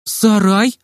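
high, rising question; taken aback, pitch lifting; surprised. A narrator's reading. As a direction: echo it in astonishment. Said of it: "Медсестра так удивилась".

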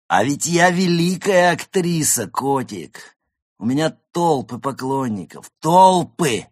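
high, loud, slow diva boasting; tipsy great actress. Acted. Tipsy goose bragging to the cat about her fans, theatrical diva. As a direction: proclaim it grandly, stretching the key words.

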